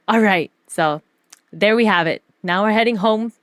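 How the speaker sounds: usual speaking voice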